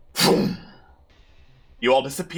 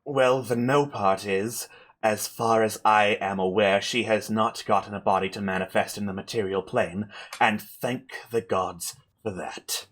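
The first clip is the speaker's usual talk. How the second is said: weary spellcaster; 6 semitones lower, 5 dB quieter than usual. Acted. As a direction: explain gravely with little strength left. Game master plays drained Amber.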